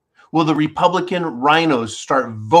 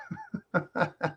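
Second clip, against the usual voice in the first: high squeaky burst of laughter; laughing; 12 dB quieter than usual. Real, not acted. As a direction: giggle in disbelief. Laughs at conspiracy label.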